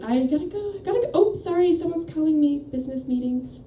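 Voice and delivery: high, rushed flustered excuse; panicked excuse. Retold or imitated. Teen hanging up on friends, high flustered excuse. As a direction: squeak out a fake excuse to escape. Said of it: "And then I said".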